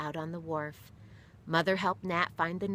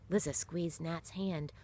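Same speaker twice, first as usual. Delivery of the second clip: plain reading voice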